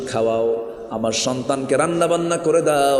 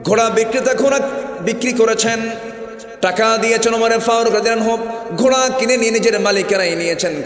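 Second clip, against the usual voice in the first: preaching at full force; 9 semitones higher than usual. Acted. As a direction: rise up and preach it hard.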